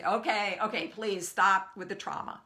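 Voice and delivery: loud, exasperated giving-in; acting out a worn-down universe giving in, loud; relenting universe. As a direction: plead loudly for it to stop. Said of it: "the universe would relent and say".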